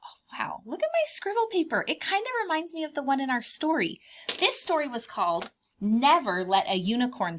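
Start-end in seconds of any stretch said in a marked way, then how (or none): 0.0-0.6 breathless whispered wonder; hushed amazement at the drawing; awed whisper. Acted. Teacher marvels at finished drawing. 0.6-4.0 high excited delight. Acted. Teacher showing off drawing.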